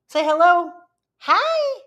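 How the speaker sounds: high-pitched greeting to a dog; high doggy-greeting voice; pet talk. Real, not acted. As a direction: coax the dog in a high, sing-out voice. Talking to the sleepy dog.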